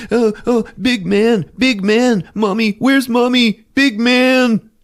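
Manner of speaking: put-on high, whimpering little-boy voice; mock crying child. Bloody Timmy found in closet, mock crying. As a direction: wail it high like a hammed-up frightened kid. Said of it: "he's crying and he's like".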